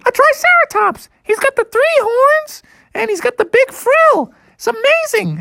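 very high squeaky little bear; excited Sister Bear. Acted. Sister Bear explaining the dinosaur to Mother, excited. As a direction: gush in a falsetto.

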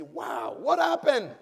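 high, loud mock astonishment; amazed. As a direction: act out someone amazed, voice leaping up on "what happened". Acts out amazed acquaintance.

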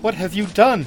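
appalled queen, moderately acted; distressed cry. A narrator's reading. Queen to her treacherous sister, crying out in alarm. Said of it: "cried Taramis".

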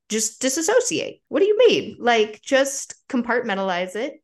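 high, loud, baffled self-parody; mock incredulous advice. Mocking her own advice to grieving people, high incredulous. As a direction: play your own dismissive self. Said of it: "And I'm like".

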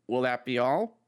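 loud, boxy drive-thru speaker; like through a cheap loudspeaker; tinny speaker voice. Acted. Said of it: "before the voice came back".

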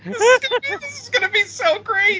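squealing high and loud; high squeaky mock voice. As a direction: squeak it out in glee. Mocking hyped wrestling fan.

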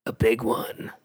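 low, confiding character voice; Wolverine. Acted. Wolverine warns his teammate, low and confiding. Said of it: "Wolverine whispers".

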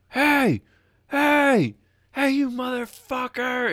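high, loud mock yell across the ice; mock-screaming stranger; imitating a scream. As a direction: holler like an angry fisherman far off. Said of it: "he is screaming at the top of his lungs".

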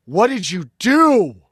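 slow, loud, high stunned demand; father's question. Retold or imitated. Father waking up and asking the kids, slow loud accusing demand.

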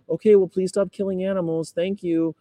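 high, unfazed sweet politeness; saccharine nice activist. Acted. Activist to someone swearing at them, saccharine sweet. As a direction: answer the insult in a high syrupy voice. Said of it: "and you're like".

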